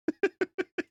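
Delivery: laugh. Real, not acted. Cracking up at clip.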